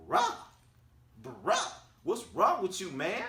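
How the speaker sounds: very high, loud incredulous "bruh"; exasperated disbelief. Retold or imitated. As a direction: squeal it in mock disbelief, pausing between the bruhs. Repeats reaction, far more exasperated.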